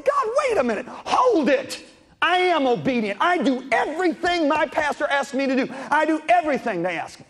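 high, urgent protest. Retold or imitated. Protesting to God, retold.

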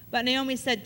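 normal talking voice

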